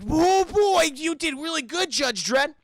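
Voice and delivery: high, gushing praise in imitation; excited. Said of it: "they're all excited".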